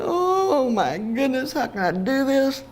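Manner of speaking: high, drawn-out tearful exclamation. Real, not acted. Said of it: "makes me cry".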